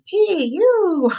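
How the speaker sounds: high, loud holler through a held nose. A narrator's reading. Girl at a friend's house, hollering at a smelly baby. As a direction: yell it while pinching your nose. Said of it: "held my nose and hollered".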